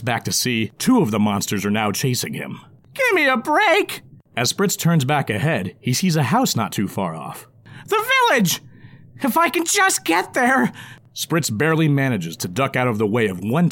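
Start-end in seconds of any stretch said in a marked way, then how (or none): 2.9-4.0 high, slow, whiny goblin groan; exasperated goblin. Acted. Goblin character voice. 7.9-10.7 high, breathless goblin cry; hopeful goblin shout. Acted. Goblin character voice.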